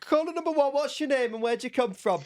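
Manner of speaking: high-pitched phone-in host; Jeremy Vine. Acted. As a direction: hail the caller in a put-on radio voice. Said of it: "do your Jeremy Vine impression".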